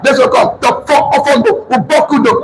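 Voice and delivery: rising excited count-up shout; excited cheer. Retold or imitated. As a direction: call it out like a winning countdown. Cheering himself up the ranks.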